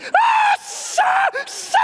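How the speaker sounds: very high screamed appeals; hammed-up panicked screeching; screaming. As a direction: shriek for the instructor over the wind. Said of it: "And I'm screaming".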